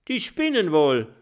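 laut und etwas höher gerufen. Acted. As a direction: ruf es spöttisch in die Runde. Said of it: "ruft Ulfbjörn der Freundliche". (Ulfbjörn ruft es lauter über die Dorfbewohner)

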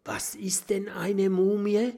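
high breathy whisper. A narrator's reading. Mathilda asks about the mummy in a whisper. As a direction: ask secretly, barely voiced. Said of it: "flüstert Mathilda".

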